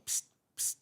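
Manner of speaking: unvoiced hiss for attention; hushed psst. Cop signalling a SWAT officer at a barricade, hushed psst. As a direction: sneak a quick psst to someone nearby.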